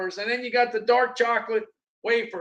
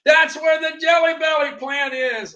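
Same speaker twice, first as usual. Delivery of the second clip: excited realization; 5 semitones higher, 5 dB louder than usual. Retold or imitated. Sudden realization retold.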